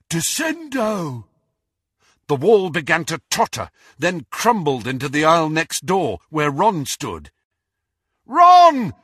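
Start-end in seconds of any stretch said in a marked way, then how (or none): 0.1-1.3 high, drawn-out incantation; shouted spell. A narrator's reading. Crabbe casts at the junk mountain, shouted spell. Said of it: "and shouted". 8.2-8.9 very high, loud cry of a name; bellow. A narrator's reading. Harry to Ron as the wall falls, bellow. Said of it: "Harry bellowed".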